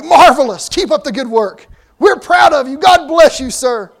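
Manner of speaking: loud, high mock enthusiasm; gushing guests. Guests praising the president without listening, loudly gushing. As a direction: gush heartily, like an oblivious well-wisher.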